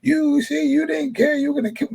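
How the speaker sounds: high, mock yell at the crowd; mock screaming. Devin screaming at the town folk, high mock yell. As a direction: squeal it out like an outraged victim. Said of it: "this is Devin screaming at the town".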